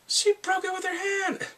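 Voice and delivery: high-pitched astonished squeal; gasp, then squeal in disbelief; amazed gasp. Acted. Acts own amazed reaction.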